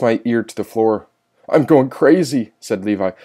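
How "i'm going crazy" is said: raised, slow, strained-to-breaking cry; desperate outburst. A narrator's reading. Trapped Levi cracking up in his cell, high desperate outburst. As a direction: cry out as if losing your mind.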